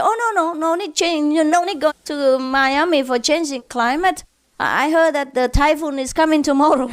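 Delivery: high, eager pidgin-flavoured husband; lightly voiced husband brushing off his wife; quick husband retort. Husband dismisses wife's trip, high and quick. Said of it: "So the husband said".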